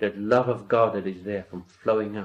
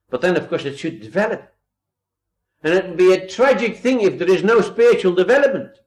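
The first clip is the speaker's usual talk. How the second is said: louder, higher preaching scold; preacher scolding believers who stay babies, louder and higher; impassioned rebuke; 8 semitones higher, 7 dB louder than usual. Acted. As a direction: chide the congregation like a preacher. Preaching at full force.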